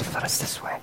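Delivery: whisper. Real, not acted. Awed rhetorical question.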